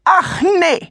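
very high mocking screech; shrill sarcastic old lady; shrieking lady. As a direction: shriek it in scornful disbelief. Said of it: "kreischte sie".

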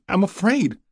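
high-pitched, fearful gasp; frightened gasp. A narrator's reading. Frightened woman confesses to a passing rider, gasping. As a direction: gasp out the fear. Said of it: "she gasped".

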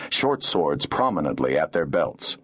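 plain reading voice

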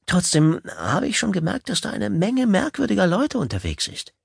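high, eager date voice; a keen, nervous suitor; eager nervous man. Acted. Gail's nervous date talk.

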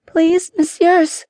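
put-on very high, slow girlish call; pleading young girl. A narrator's reading. Younger captive girl calls to passing gentlemen, high pleading. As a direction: call out for help in a high girl's voice. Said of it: "called the younger girl".

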